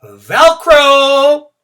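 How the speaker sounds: very high, loud triumphant cry. Acted. Junie B showing the team her Velcro shoe, loud and proud. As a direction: announce it like a victory.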